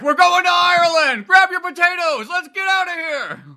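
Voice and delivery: high, loud hammed-up Irish dad call; rally the family like a mad dad; mock-rousing shout; excited mock shout. Dad yelling excitedly, shout heard.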